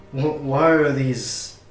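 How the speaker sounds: ordinary talk, nothing marked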